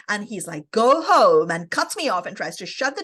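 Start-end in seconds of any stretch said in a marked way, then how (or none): 0.7-1.5 loud, abrupt voice of an unwilling householder; brusque dismissal. Retold or imitated. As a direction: cut them off with a loud order. Man rudely cutting her off.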